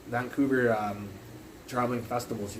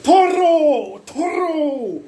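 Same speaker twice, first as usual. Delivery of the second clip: very high, very loud holler; mad retold yelling; 11 dB louder, much slower than usual. Mad at the clown at the bus stop, yelling back. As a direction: scream the taunt right back at him. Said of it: "'Cause I was mad too at this point".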